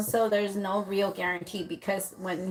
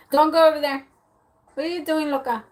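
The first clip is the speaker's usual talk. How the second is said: louder and higher warning call; 7 semitones higher, 5 dB louder, faster than usual. Real, not acted. Reader breaking off to call out to someone nearby, loud. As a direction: stop someone across the room.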